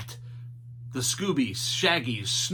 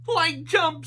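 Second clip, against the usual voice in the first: high, loud goofy call; Shaggy voice; 13 semitones higher, 5 dB louder than usual. A narrator's reading. Shaggy telling Scooby to jump off the lift, high and loud. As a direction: shout it like a cartoon slacker. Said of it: "said Shaggy".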